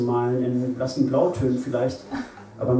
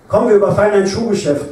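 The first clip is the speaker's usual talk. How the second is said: high, loud order; bold command; 8 semitones higher, 7 dB louder than usual. A narrator's reading. Ruth urges Lenz loudly to raid a shoe shop, ringing order. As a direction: Ruth barks her plan to rob a shoe shop, raised and commanding. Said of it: "befahl sie".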